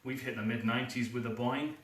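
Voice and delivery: ordinary talk, nothing marked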